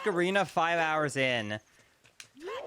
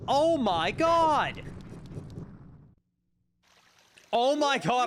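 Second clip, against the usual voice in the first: high relieved exclamation; drawn-out cry of relief; 9 semitones higher, slower than usual. Real, not acted. Finally reached field.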